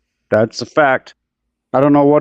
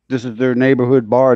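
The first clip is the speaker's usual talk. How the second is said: normal talking voice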